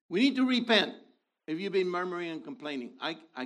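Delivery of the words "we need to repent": urgent call. Real, not acted. Preacher calling to repent again.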